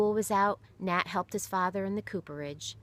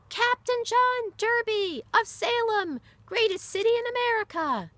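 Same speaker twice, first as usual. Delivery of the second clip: roaring crowd; 9 dB louder than usual. Acted. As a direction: roar it like a cheering crowd. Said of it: "The others roared the answers".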